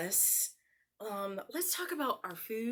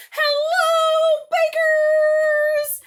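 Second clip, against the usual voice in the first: much higher and louder, long drawn hello; cheerful shout; 19 dB louder, much slower than usual. Shown as a demonstration. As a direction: call out to the farmers. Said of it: "One, two, three".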